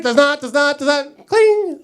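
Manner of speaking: compute noisily, then ding; calibration machine. Acted. Woman's lie detector processes his story, machine noises.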